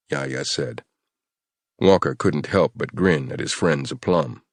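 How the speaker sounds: ordinary delivery, nothing marked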